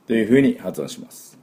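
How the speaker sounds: ordinary talk, nothing marked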